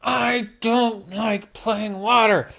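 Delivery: slow, high, sulky mock anger; furious Hannah. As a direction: drag out the complaint. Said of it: "just furious at Peppa".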